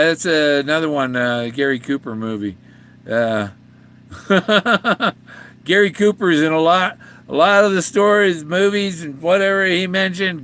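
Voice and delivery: ordinary delivery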